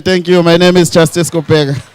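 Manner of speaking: ordinary talk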